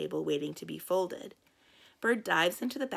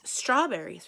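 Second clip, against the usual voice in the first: higher, louder bird cry; a contained narrated squawk; mild shriek; 5 semitones higher, 6 dB louder than usual. A narrator's reading. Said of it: "sh-, shrieks".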